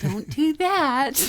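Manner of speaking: high laughing warning. Real, not acted. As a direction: laugh while saying it.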